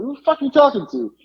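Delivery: high, fast retold indignation; pissed off. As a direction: fire it back like an offended customer. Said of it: "now he's pissed".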